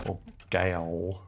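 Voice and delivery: "gale" said slowly and carefully; careful, drawn-out "gale"; spelled-out pronunciation. Shown as a demonstration. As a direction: sound out "gale" as a possible reading. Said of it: "That's G A O L".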